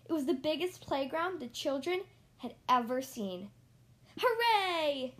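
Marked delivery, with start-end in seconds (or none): 4.2-5.1 high, loud cheer of joy; cheering yell. Acted. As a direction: shout hooray like happy kids. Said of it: "they yelled".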